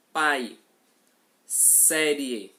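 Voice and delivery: clear, careful example words for a class; teacher sounding out pai and série so students hear the air leave only through the mouth; demo words. Shown as a demonstration. Teacher showing the oral diphthong in Pai and série, spoken loud and clear.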